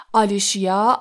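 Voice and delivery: plain reading voice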